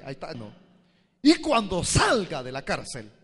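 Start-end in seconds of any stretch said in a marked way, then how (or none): 1.2-3.1 firm declaration. Retold or imitated. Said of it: "así se lo dije".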